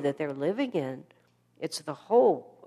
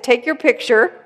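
loud, firm call to the photographers; commanding call out; 6 semitones higher, 11 dB louder than usual. Retold or imitated. Master calling out to the photographers, loud and commanding. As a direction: boom it out like a master taking charge.

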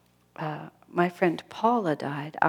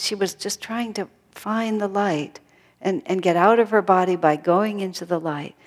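usual speaking voice

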